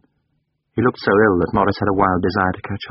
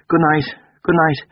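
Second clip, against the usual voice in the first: much higher curt dismissal; Durham, curt; 9 semitones higher than usual. Acted. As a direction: shut the talk down. Durham cutting off.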